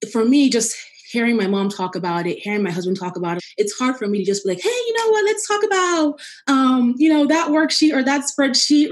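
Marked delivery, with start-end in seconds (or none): 4.6-8.9 high, bright pretend-normal chirp; mock cheery voice. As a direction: sing it out like nothing's wrong. Mock cheerful business-as-usual voice.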